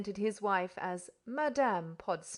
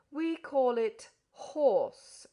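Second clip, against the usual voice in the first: slow, loud, patient correction; with forbearance; 3 semitones higher, 6 dB louder, much slower than usual. A narrator's reading. Podsnap correcting the guest, slow and forbearing. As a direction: correct him with heavy patience. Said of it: "with forbearance".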